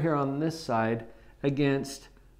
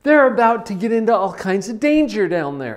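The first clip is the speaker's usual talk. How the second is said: high, loud panicked alarm; worried parent yelling down in alarm; freaked-out parent; 7 semitones higher, 10 dB louder than usual. Pretend. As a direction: panic as you spot your kid near the cliff. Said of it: "they freak out just a little bit".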